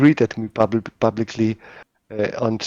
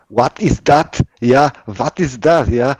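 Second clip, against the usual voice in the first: louder, higher mock-German accent; put-on German accent, pushed louder; 4 semitones higher, 6 dB louder than usual. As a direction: imitate the friend's German-accented English. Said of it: "my friend can talk like that".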